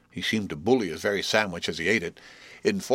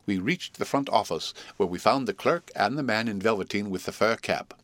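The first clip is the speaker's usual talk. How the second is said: ordinary delivery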